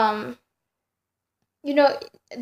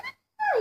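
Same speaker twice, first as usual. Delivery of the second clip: retold scream; 5 dB quieter than usual. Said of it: "I'm screaming".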